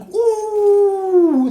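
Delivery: long, loud high "ooh"; sustained whooping ooh, held high; high excited whoop. Real, not acted. Streamer reacting to the wheel, high excited whoop. Reacts to a near big win.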